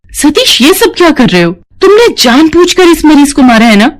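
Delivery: high-pitched, accusing outburst; angry. Acted. Nurse confronting the doctor over a killing, angry. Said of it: "Meera गुस्से में आ जाती है".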